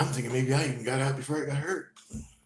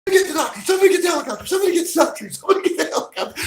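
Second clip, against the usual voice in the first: much higher, louder frantic shrieks; panicked screaming guy; 14 dB louder, faster than usual. Retold or imitated. Panicked bystander screaming for a doctor and helicopter, frantic shrieking. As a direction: shriek orders like you're spinning in circles. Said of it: "screaming at the birds".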